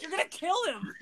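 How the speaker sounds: high-pitched, held-back retold scream; mock scream. Friend protesting the soap in the drink, mock scream. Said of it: "screaming at him".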